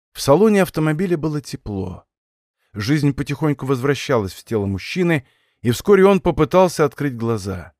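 ordinary delivery, nothing marked

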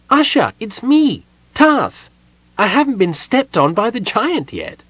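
very high, bright-voiced kender chatter. A narrator's reading. Kender Tas cheerfully announcing himself to Usher, high and chirpy. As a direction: pitch it way up like a chirpy little kender.

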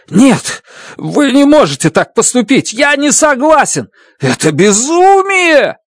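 high, shouted desperate refusal; panicked protest. Acted. Grace panics as Stratt's plan takes shape, desperate shout. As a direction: cry out in panic.

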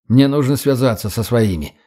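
plain reading voice

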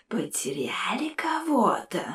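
slow, soft, hissing ghostly whisper; mocking ghost whisper; snide ghost. A narrator's reading. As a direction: sneer it in a breathy skull whisper. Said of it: "ехидно спросил призрак".

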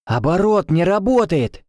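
high alarmed shout. A narrator's reading. Karni shouts to the team, high alarmed. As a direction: call out the problem. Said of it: "крикнула Карни".

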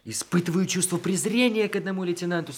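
high, fast teasing list of insults; mock contempt. He teases her by mocking himself, high playful scorn.

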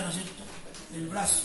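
ordinary talk

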